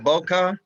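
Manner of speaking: "bokeh" ending in a "kuh" sound; trying out pronunciation. Shown as a demonstration. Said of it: "How do you say this damn word".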